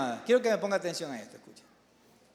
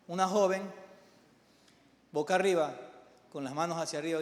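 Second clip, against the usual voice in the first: ordinary talk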